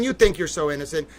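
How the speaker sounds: normal talking voice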